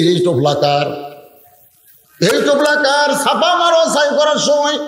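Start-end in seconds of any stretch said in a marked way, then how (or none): 2.2-3.1 high, ringing challenge; acted indignant question, pitched right up; accusing demand. Accuser repeats question, much higher. 3.2-4.8 high-pitched, chanted reproach; acted outrage, pitched high; indignant accusation. Acted accusation by angry friends.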